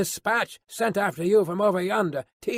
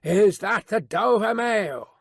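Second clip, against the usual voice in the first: slow, raised call through mist; calling from afar; 5 semitones higher, 4 dB louder, slower than usual. A narrator's reading. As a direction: hail the coach, drawing out the words. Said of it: "a man's voice called from the mist".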